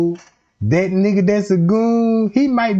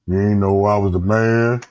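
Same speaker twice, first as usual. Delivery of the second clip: breaking the news; 8 semitones lower than usual. Acted. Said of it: "Trey Gang gotta break the news like".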